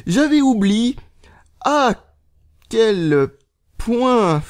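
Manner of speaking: high, word-by-word dictation with gaps; typing aloud. Real, not acted. Vlogger reading out his tweet as he types, halting. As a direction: pause after each word as you type.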